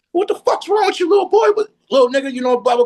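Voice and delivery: high, loud mock shout at the door; startled shout. Neighbour shouts at the boy knocking late. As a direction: belt it like a woken neighbour. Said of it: "Tiger comes to the door".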